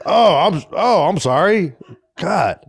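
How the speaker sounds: mock-flustered fatherly apology; impression of dad. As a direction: imitate a dad caught out, slow on the apology. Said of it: "He sounds like this".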